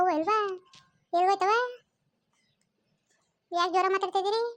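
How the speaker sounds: a busybody aunty barging in to ask what the fuss is; nosy village aunt. Acted. Same actor voices nosy village aunt.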